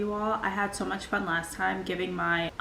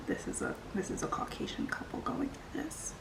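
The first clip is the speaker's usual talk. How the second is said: a much softer whisper, confiding; whispered aside; 5 semitones higher, 11 dB quieter than usual. Real, not acted. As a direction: whisper it like a secret to the viewers. Confiding aside about her own lore.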